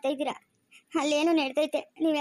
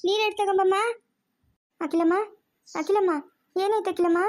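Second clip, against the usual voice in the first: urgent higher-pitched calls; alarmed calling; 5 semitones higher than usual. Acted. Calling for water and rousing fainted Akhila, alarmed. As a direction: call out to rouse someone who has fainted.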